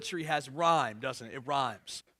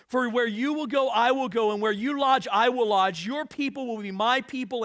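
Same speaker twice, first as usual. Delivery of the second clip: raised, declamatory Bible reading; scripture reading; 5 semitones higher, 6 dB louder than usual. Acted. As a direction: proclaim the vow, loud and pitched up at the peaks. Reads scripture aloud with force.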